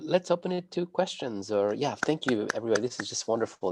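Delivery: usual speaking voice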